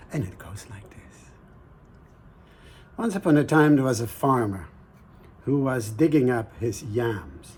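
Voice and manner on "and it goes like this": hushed storyteller whisper. A narrator's reading. Storyteller to listeners, whispered. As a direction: lean in and whisper the opening.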